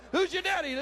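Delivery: high, boisterous mock wake-up call; playful hollering. Dad waking his married sons at night, playful hollering. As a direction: burst in with it, teasing and high-pitched.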